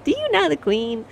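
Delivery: American fan voice, eager mock curiosity; gushing American. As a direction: mimic a wide-eyed American asking questions. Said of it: "I know Americans are like".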